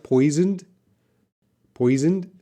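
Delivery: "Poisoned" ending on a clear d sound, not -ed. Shown as a demonstration. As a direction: make the final d in "Poisoned" heard. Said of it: "D sound".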